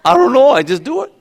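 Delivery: put-on young athlete caught out, high and loud; clueless answer. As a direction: squeak out a shrug of an answer. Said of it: "that's a deep answer".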